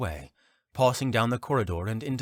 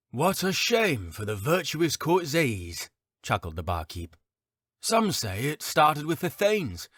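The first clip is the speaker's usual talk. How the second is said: barkeep voice; 7 semitones higher than usual. Acted.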